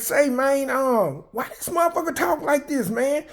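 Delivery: high, fast, incredulous inmate voice; imitating an inmate. Inmates asking about her proper talk, high and fast. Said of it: "They were like".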